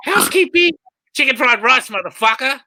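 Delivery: high, loud jokey room-service call; sing-song it through the cabin door; mock housekeeping call. Comic imagining bringing food to the locked-up drunk, high mock call. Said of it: "Just knock on the door".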